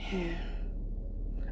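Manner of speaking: slow exasperated breath out; long weary sigh. Retold or imitated. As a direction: let out a tired sigh. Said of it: "she was like".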